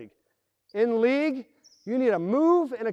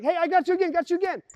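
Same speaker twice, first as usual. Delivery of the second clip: higher mock reply; teammate answering; 10 semitones higher, 3 dB louder, faster than usual. Teammate answering the cutter, higher and bright. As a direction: reassure the cutter up high. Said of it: "and they're like".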